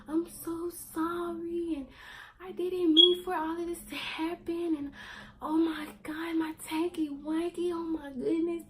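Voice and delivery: high, slow crying mom voice; voicing mom sobbing sorry, high and slow; mother's apology. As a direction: plead like a tearful mother. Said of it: "She was like".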